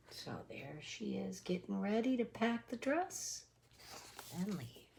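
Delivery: very soft murmured commentary; hushed aside. Real, not acted. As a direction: drop almost to a hush.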